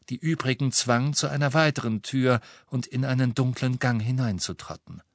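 ordinary delivery

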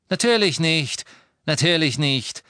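impatient. A narrator's reading. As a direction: dismiss it impatiently. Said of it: "erwiderte Lucius ungeduldig". (ungeduldig abwinkend)

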